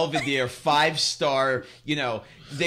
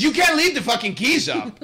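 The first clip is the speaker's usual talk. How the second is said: mock yell; 11 semitones higher, 6 dB louder than usual. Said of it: "He goes".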